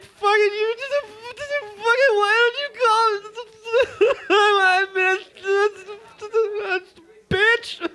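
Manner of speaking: high, wailing, half-crying voicemail rant; sobbing drunk rant. Retold or imitated. Ranting at his ex's voicemail in a high sobbing wail. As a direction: blubber it out like a heartbroken drunk.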